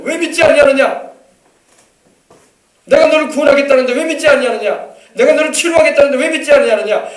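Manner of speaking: preacher voicing Jesus scolding unbelievers, loud and stern; the Lord rebuking. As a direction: thunder the Lord's reproach at doubters. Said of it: "주님이 우리 꾸짖으세요".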